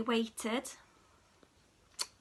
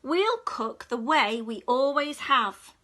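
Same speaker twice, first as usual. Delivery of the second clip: stern; 8 dB louder than usual. Acted. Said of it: "snapped the cat".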